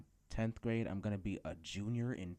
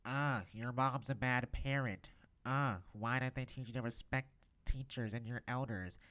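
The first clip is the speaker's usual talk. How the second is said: higher, louder put-on teacher voice; mock teacher voice; 4 semitones higher, 4 dB louder than usual. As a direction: put on a scolding teacher's voice, pitched up. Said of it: "gotta put on the voice again".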